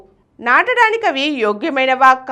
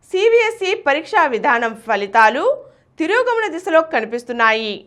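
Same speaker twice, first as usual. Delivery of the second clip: ordinary talk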